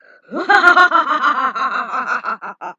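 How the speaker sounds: loud villainous cackle; maniacal laugh. Acted. Villain cackling loudly over the fallen heroes. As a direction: cackle like a triumphant madman. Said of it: "a maniacal laugh".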